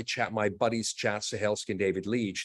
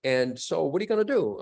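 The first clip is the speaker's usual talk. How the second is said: high, bright mock of an encouraging actress; lightly voiced cheerful mentor; imitating Allison Janney; 8 semitones higher than usual. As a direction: do her voice a bit higher and eager. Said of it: "She goes".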